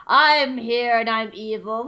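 slow, menacing robot general; lightly voiced cyborg villain; evil villain voice. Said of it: "he's like".

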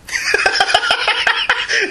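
high, sustained burst of laughter. Real, not acted. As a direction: crack up at the running joke. Laughs at replayed running joke.

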